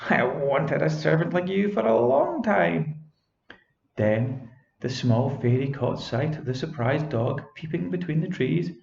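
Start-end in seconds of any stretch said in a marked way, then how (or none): 0.1-3.0 witch voice. Acted. Witch continues speaking.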